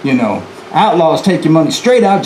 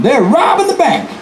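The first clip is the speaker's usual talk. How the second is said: pitched-up shout of alarm; repeated alarm yell; 10 semitones higher than usual. Retold or imitated. Young man on the street repeating the alarm, high yell. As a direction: call it out to the street. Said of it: "He repeats that alarm".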